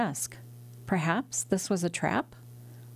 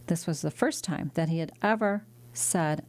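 plain reading voice

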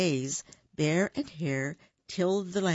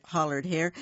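plain reading voice

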